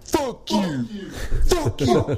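hammed-up angry boss; mock angry boss. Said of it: "and he's like".

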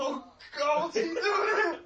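high, very loud laughing outburst; loud, high-pitched shriek of amusement; laughing shout. Real, not acted. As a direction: cry out through helpless laughter. Excited reaction to the film.

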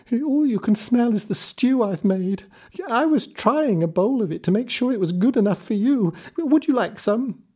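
put-on high, fast soothing old woman's voice; placating old woman. A narrator's reading. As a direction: sweet-talk the giant, high and eager. Old woman distracts giant.

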